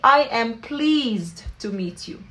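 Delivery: slow, clearly spaced English phrase; slow teaching model. Shown as a demonstration. Teacher repeating the phrase slowly for students. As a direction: spell it out slowly. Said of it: "بنحكي".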